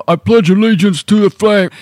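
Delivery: a half-working Rocky voice; failed Rocky impression. Shown as a demonstration. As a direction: try Rocky, lose it. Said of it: "It doesn't sound like Rocky".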